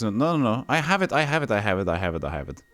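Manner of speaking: ordinary talk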